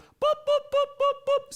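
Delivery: high monotone robotic boops, evenly spaced; rapid-fire boops held on one high note; high-pitched boops. Pretend. Streamer counting out a donor's reward, high boops.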